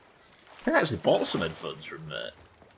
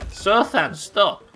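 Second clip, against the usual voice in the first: mock calling out; 10 semitones higher, 5 dB louder, much slower than usual. Calling out to chat member.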